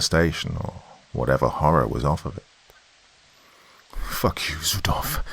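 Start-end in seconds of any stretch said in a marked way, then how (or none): 4.0-5.2 hushed, voiceless yell of fear; fearful, strangled whisper; pure fear. A narrator's reading. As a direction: curse him in a terrified whisper-scream. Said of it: "yelled down it in pure fear".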